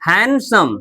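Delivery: "हैंडसम" said as HAN-sum, no D; "हैंडसम" with a silent D. Shown as a demonstration. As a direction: drop the D in "हैंडसम", go straight from n to s. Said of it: "हैंडसम नहीं".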